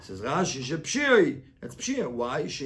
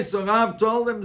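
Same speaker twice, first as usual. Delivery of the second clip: ordinary talk, nothing marked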